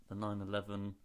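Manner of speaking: ordinary talk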